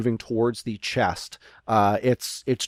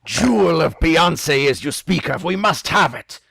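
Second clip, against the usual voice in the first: hammed-up pirate declaration; pirate captain; 5 semitones higher, 4 dB louder than usual. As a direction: demand it as a covetous captain. Game master voices greedy pirate captain.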